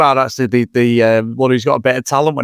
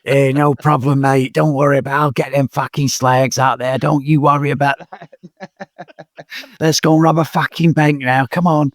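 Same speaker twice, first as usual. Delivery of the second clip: Cockney geezer. Acted. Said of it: "Cheers, Ray Winston".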